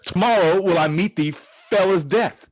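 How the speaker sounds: rising to a loud furious threat; angry. Acted. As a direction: hurl the threat back at him. Achilles answers Hector's challenge.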